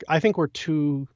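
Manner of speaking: ordinary talk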